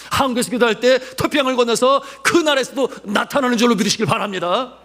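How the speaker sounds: high, loud pulpit exhortation; full-force preaching. Real, not acted. Preacher urging congregation to believe, full-force preaching. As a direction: drive the promise home to the congregation.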